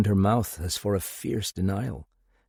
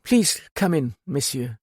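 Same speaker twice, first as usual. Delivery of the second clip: lightly voiced hostess; 6 semitones higher than usual. A narrator's reading. Hostess greets visitors.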